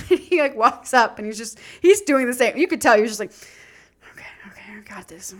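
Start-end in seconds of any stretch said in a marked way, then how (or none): 4.1-5.3 whispering to psych himself up; whispered self pep talk. Retold or imitated. Fiance pumping himself up before the approach, whispered. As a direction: imitate a hushed little pep talk. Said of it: "pumps himself up".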